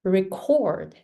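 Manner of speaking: "record" with weak re and strong CORD; stress on CORD. Shown as a demonstration. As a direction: model verb "record", second syllable stressed. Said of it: "cord is stronger than re".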